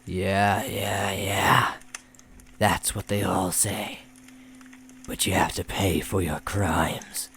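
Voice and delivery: slow, dismissive demon voice; mocking demon. A narrator's reading. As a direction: brush off the plea slowly. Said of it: "the demon said".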